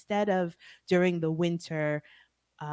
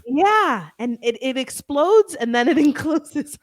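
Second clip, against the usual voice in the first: laughing; 8 semitones higher, 10 dB louder than usual. Real, not acted. Excited laughing agreement.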